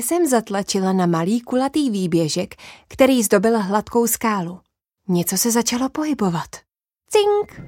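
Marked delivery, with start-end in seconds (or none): none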